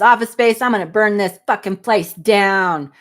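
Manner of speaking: loud movie line, slow at the end; booming out the Office Space quote. Retold or imitated. Reading a fan's movie quote aloud, mock loud. Quoting stapler guy line.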